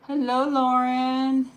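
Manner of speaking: high, slow, drawn-out hello; sing-song greeting. Real, not acted. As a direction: greet her sweetly. Greeting a viewer sweetly.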